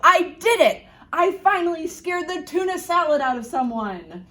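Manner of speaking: high, loud monster gloating in triumph; triumphant shout. Acted. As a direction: crow over your victory. Monster thinks he scared boy.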